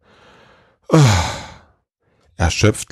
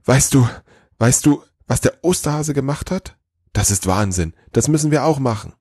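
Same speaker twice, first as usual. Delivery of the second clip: breathless excitement; 7 semitones higher than usual. A narrator's reading. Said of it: "war er außer Puste und keuchte".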